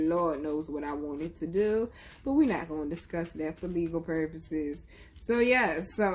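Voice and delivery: ordinary talk, nothing marked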